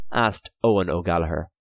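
ordinary delivery

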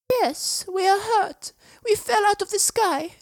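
put-on very high child's voice. A narrator's reading. Child in a bush answers the boy, very high voice. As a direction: answer as a small, foreign child. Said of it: "the voice was definitely a child's".

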